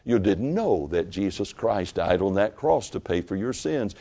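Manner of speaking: ordinary talk, nothing marked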